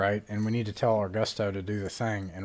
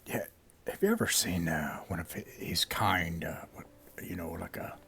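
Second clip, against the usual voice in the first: whisper; 4 semitones lower, 6 dB quieter than usual. Acted. Leaning over to whisper.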